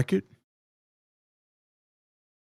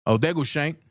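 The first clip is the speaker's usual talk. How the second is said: ordinary delivery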